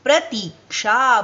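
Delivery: प्रतीक्षा said slowly with a long ती; slow careful प्रतीक्षा with the long ती; प्रतीक्षा stretched on the ती; correct pronunciation. Shown as a demonstration. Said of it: "शुद्ध शब्द है".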